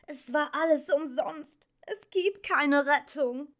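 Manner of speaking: high-pitched, desperate outburst; almost wild despair. A narrator's reading. Atreyu tells the Empress there is no rescue, despairing. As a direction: blurt it out hopelessly. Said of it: "stieß Atreyu fast wild hervor".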